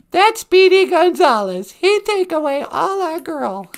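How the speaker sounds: very high, loud cartoon Mexican accent; hammed-up Mexican accent, high-pitched. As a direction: squeak it out in a mock Mexican accent. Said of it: "that's a Mexican accent".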